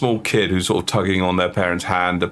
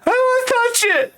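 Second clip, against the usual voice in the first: put-on whiny kid; faster than usual.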